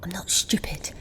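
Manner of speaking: soft clipped whisper. Acted. Breda refusing her brother, whispered. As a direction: dismiss him under your breath.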